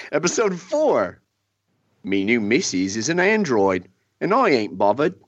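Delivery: lazy put-on Cockney shrug; Cockney accent. [name] delivers the android-wife parody line in a light mock Cockney. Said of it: "with your best accent".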